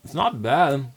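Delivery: talking with a burning mouth; spicy heat in the sinuses; mouth burning from spicy food. Real, not acted. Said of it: "it's in the sinuses".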